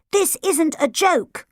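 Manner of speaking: slow, sharp reprimand. A narrator's reading. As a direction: cut the joking short. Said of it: "said Podge sharply".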